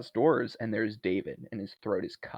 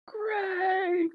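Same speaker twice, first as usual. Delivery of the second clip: high, drawn-out mock wail; hammed-up grief, dialing it up; overdramatic cry. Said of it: "does this dramatic thing".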